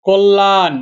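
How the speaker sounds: কল্যাণ as "kol-laan", l held long; doubled l. Shown as a demonstration. Teacher showing the doubling rule, কল্যাণ with a long double l. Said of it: "দুইবার উচ্চারণ হবে".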